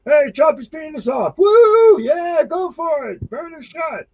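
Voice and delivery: very high mock whooping shout; mocking cheering crowd. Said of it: "And people are like".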